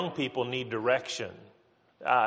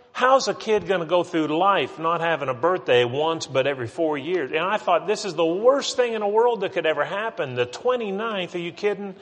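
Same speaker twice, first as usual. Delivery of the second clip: higher-pitched, incredulous protest; mock dismay; 5 semitones higher than usual. New father retelling his worry over leap-day birthday, mock dismay. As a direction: replay your own panic, pitch climbing as it goes.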